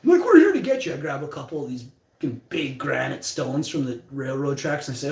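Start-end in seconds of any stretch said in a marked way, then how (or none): 0.2-0.9 much higher and louder threat; menacing bully. Retold or imitated. Ambushing bullies threaten the kid, loud menacing threat. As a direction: bark the threat like a tough guy.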